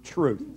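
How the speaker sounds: truth set apart, falling firmly; stress on truth. Shown as a demonstration. Preacher stressing his theme word truth. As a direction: lean on the word truth as the key word. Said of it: "the emphasis is on that very first word".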